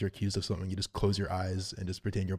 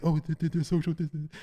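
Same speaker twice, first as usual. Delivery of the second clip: higher-pitched, tripping over the words; mock stammering; 7 semitones higher than usual. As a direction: imitate someone flustered and stammering off. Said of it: "he's sort of just stammering off".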